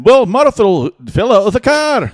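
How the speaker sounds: mock Scottish storyteller; Scottish accent. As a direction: ham up a Scottish street joke. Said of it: "street jokes with Scottish accents".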